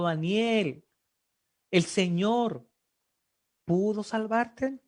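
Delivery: ordinary talk, nothing marked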